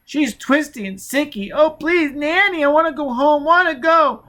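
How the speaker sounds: put-on very high, loud, begging little-girl voice; pleading frightened child. A narrator's reading. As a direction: wail for Nanny like a terrified small child.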